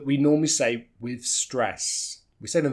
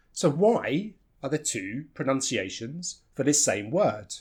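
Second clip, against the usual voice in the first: usual speaking voice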